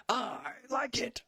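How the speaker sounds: very high, squeezed catchphrase voice; slow, piercingly high mock quote; RoboCop movie quote. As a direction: stretch it out in a hammed-up falsetto squeal. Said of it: "do a quote from RoboCop".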